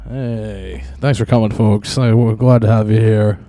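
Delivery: loud showman welcome; mock host welcome. Host welcoming folks to the show, loud showman voice. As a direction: boom it out like a game show host.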